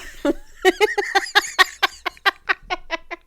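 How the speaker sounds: long, high-pitched giggling fit; high laugh. Real, not acted. Storyteller laughs after admitting it was hot, high giggling. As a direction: burst into prolonged high laughter.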